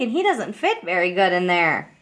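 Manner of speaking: ordinary talk, nothing marked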